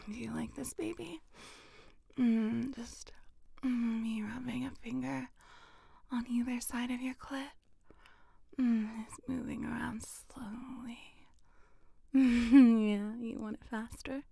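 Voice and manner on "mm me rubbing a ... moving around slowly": soft unvoiced whisper, close to the ear; intimate whisper. Whispering close to a partner, intimate and teasing. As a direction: breathe every word like a secret to a lover.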